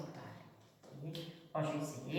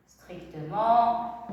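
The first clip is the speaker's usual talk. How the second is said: "Strictement" drawn out very slowly, syllable by syllable; slow dictation; much slower than usual. Shown as a demonstration.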